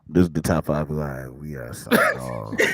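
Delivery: a hint of a deep radio host; lightly voiced radio announcer sign-off. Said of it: "[name]'s doing radio voice".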